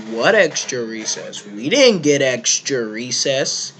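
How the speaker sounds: loud puzzled friend; confused protest. Retold or imitated. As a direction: blurt it out, baffled and loud. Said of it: "he's like".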